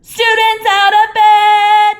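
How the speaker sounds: very loud, high, slow sing-song bellow. A narrator's reading. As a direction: bellow it down the halls. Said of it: "Peeves bellowed".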